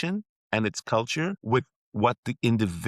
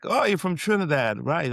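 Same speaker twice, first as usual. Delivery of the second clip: higher, lively mock voice of a stranger; mimicking an outsider; 8 semitones higher than usual. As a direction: play the clueless outsider brightly. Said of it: "And they're like".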